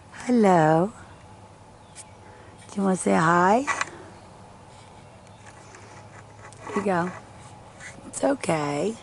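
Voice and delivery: high coaxing voice to tiny creatures; sing-song sweet talk to little beings. Real, not acted. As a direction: coax them like a shy pet.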